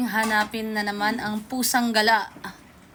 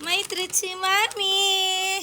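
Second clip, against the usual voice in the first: sing-song to cat; 6 semitones higher, much slower than usual. Real, not acted.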